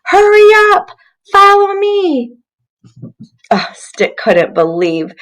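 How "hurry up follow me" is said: high, loud call up from the treetop; a child's excited hurry-up call; excited shout. Acted. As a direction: yell down to the friend far below. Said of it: "shouted to Stick".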